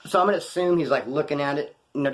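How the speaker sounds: normal talking voice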